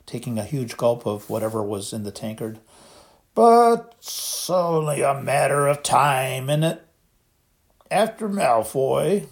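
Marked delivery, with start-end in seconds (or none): 3.3-9.3 put-on slow, sorrowful voice of a drunk giant; a giant close to tears over his job; miserable Hagrid. A narrator's reading. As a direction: let the words sag with self-pity.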